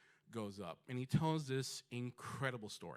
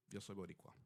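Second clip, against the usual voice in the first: soft, low, inward statement; quiet realization; 3 semitones lower, 8 dB quieter, faster than usual. Real, not acted. Alone at the bus stop, quietly claiming his identity. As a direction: say it to yourself, settled and sure. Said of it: "I sit down and I realize".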